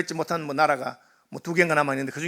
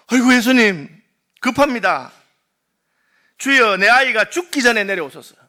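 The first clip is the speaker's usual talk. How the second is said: urgent plea; 4 semitones higher, 5 dB louder than usual. Retold or imitated. Desperate father begging Jesus.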